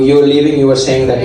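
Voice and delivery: usual speaking voice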